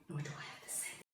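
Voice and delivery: breathy whispered aside under the breath. Real, not acted. Comparing herself to Nigella, whispered aside. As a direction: drop to a whisper, confiding.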